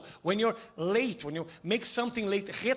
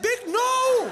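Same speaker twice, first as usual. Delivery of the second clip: outraged shout; 5 dB louder than usual. Retold or imitated. Outraged reply in retold chat.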